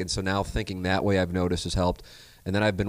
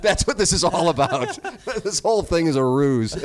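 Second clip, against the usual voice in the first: high, laughing through the words; chuckling while he talks; 9 semitones higher, 5 dB louder than usual. Real, not acted. Host laughing through his words.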